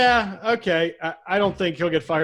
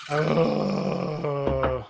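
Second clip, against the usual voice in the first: drawn-out, level "ugh"; long, undecided groan; long groan of indecision; 3 semitones lower than usual. Real, not acted. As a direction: groan at length while torn over a choice. Torn over a hard pick.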